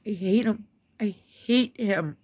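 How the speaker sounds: loud, real anger; emphatic, drawn-out loathing; hateful venting. Real, not acted. Ranting about a hated politician.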